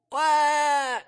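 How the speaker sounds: put-on high shouted baby wail with temper; mock angry baby cry. Preacher to children, imitating a baby crying in protest. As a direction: wail like a baby held too tight. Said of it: "he gives it all the more".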